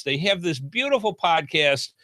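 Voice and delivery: usual speaking voice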